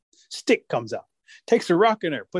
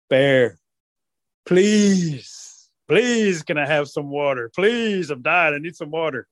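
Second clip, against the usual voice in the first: pleading rabbit; slower than usual. Acted. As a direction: beg as if dying of thirst. Rabbit begging Bear for water.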